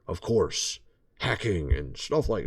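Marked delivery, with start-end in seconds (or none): none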